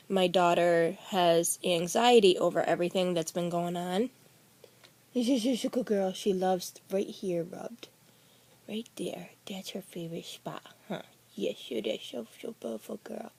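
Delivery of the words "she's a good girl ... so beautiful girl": hushed, tender words to the dog; soft pet talk. Real, not acted. Owner whispers praise while petting her dog, hushed and tender.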